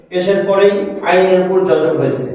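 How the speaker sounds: ordinary talk, nothing marked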